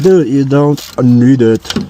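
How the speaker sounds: slow, drawn out; dragged-out dude, you don't need it; slow, drawn-out insisting. Retold or imitated. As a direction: lean on each word, slow and tired. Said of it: "I'm like".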